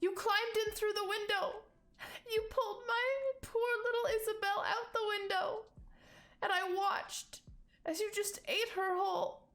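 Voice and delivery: high-pitched, distraught mother; hysterical accusation. Acted. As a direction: wail the accusation at the culprit. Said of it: "this woman just seems hysterical".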